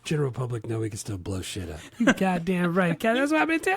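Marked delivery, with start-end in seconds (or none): 2.0-3.8 much higher put-on voice; hammed-up quote. Friends quoting the movie to each other, high silly voice. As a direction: toss it off in a silly cartoon voice.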